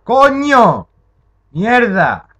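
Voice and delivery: startled scream. Real, not acted. Gamer startled by a monster, screaming in fright. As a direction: shriek at the sudden scare. Jump scare.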